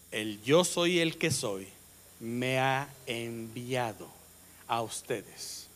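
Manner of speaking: slow, hushed and solemn, each word weighed; solemn voice of God. Acted. Preacher voicing God to Moses, slow and hushed.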